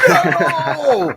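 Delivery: high wailing no, laughing; mock anguished cry. Streamer mocking a sore loser, wailing high no. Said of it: "He's like".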